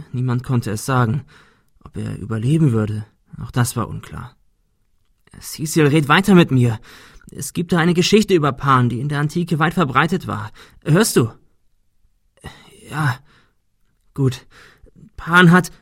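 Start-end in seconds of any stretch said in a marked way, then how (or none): 5.4-15.7 higher, quick, nervously chatty; Ben telling the myth. Acted. Ben talking to injured Cecil, anxious storyteller voice. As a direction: rattle it off to keep Cecil awake.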